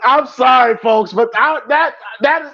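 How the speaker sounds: high, tearful apology; overcome with emotion. Real, not acted. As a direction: apologise through real tears. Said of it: "I don't know why that just hit me".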